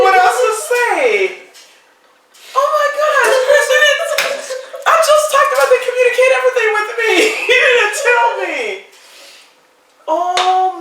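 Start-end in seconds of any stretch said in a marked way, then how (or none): 2.5-8.6 higher, rapid, disbelieving; overwhelmed by the surprise, words tumbling out; real shock at a friend's proposal; stunned surprise. Real, not acted.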